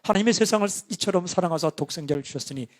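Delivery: scripture reading demo. Shown as a demonstration. Pastor shows how he read the Bible aloud. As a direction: read John as on the tapes. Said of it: "이렇게, 이렇게 읽었어요".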